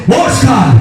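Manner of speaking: imitated shout of a name; sudden call. Preacher retelling a voice calling him in his sleep, sudden shout. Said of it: "escucho una voz que me dice".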